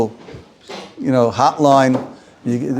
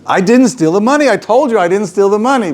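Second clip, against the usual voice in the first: mock protest; 6 semitones higher than usual. Rebbe protesting again.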